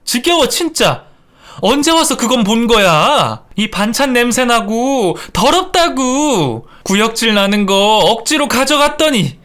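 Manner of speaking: high-pitched acted tantrum; angry yelling. Daughter-in-law overturning the table on her in-laws, yelling. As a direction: scream out your disgust, voice held high. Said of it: "밥상을 뒤엎으며 소리를 질렀습니다".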